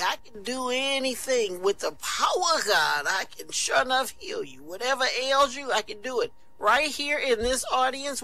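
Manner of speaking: hammed-up evangelist pitch; radio evangelist. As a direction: preach it like a radio faith healer. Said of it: "he was saying things like".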